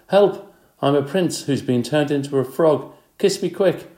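high, loud pleading frog prince; a hammed-up cry of the frog prince; frog prince crying for help. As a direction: beg for a kiss like a desperate enchanted frog. Teacher reads joke tag aloud.

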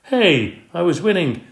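high indignant protest. A narrator's reading. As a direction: complain he was winning. Game ruined, protest.

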